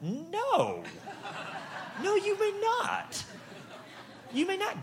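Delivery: very high, slow, laughing no; mock-prim high refusal; emphatic refusal. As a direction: draw out a squeaky, laughing refusal. Refusing someone chapstick.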